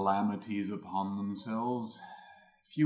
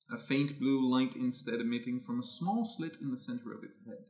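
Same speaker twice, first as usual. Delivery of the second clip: ordinary talk, nothing marked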